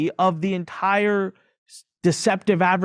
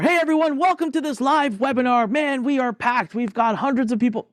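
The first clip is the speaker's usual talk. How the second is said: high, bright-eyed host welcome; mock pitch of an overeager webinar host; hyped webinar host; 9 semitones higher than usual. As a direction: sell the fake packed live webinar. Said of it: "Evergreen webinar".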